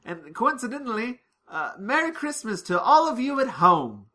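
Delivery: imitating the doctor breaking the fourth wall; to the camera. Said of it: "turns to the camera and goes".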